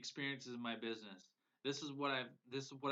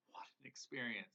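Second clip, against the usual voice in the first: tearful awe; 6 dB quieter than usual. Real, not acted. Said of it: "It makes my eyes water".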